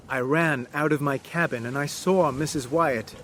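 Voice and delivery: plain reading voice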